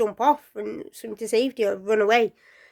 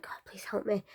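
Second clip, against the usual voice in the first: soft prayer; 12 dB quieter than usual. Acted. Praying in danger.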